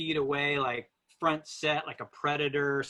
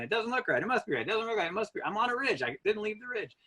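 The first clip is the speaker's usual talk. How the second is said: higher, anxious back-and-forth with himself; looping doubt; 7 semitones higher, faster than usual. Retold or imitated. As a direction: argue with yourself, half panicked. Looping doubt, lost at night.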